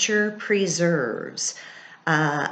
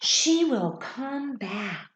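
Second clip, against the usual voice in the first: small hopeful fawn voice; 6 semitones higher, slower than usual. Acted. Fawn continues.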